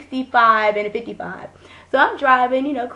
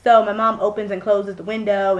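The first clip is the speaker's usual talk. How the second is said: ordinary talk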